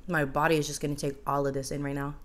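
chewing between words; mouth partly full. Real, not acted. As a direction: burger held at lips. Seen 3: Chews before, talks holding burger at mouth, bites again at end.

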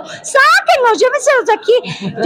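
high hammed-up demand to get them out; high mock pleading cry; mock exasperated outcry. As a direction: play a fed-up audience member, over the top. Mock outburst at bad festival.

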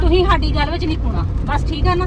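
loud retort. Acted. Wife silencing husband about her brother, loud angry retort. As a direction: tell him off, loud and firm.

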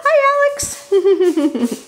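sing-song slow greeting to a pet; high pet-talk voice. Real, not acted. Greeting the dog.

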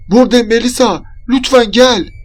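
high, loud plea; pleading call. A narrator's reading. Trapped friend answering the searcher, calling out pleadingly. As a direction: call out, begging to be found.

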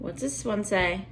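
ordinary delivery

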